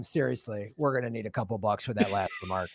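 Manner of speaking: deep, low, quiet menacing collector voice; mock YouTube enforcer. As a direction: lean in like a shady debt collector. YouTube rep demanding money, mock.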